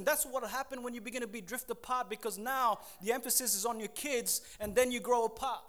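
ordinary delivery

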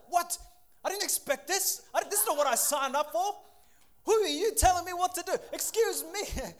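high, loud, outraged mock protest; hammed-up offended huff, drawing out excuse me; mock indignation. Preacher mocking an offended spouse, loud and indignant.

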